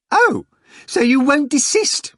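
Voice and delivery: very high, loud raging screech; enraged scream. A narrator's reading. Manager losing his temper on the phone, screaming in rage. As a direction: scream it at the caller. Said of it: "screamed in a rage".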